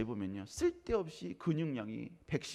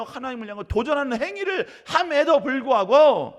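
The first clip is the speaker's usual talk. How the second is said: much higher, louder building to a near shout; climactic preaching; 12 semitones higher, 16 dB louder than usual. Acted. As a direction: rise to a fever pitch of indignation. Climactic preaching, pitch soaring. (절정으로 치닫는 외침 설교)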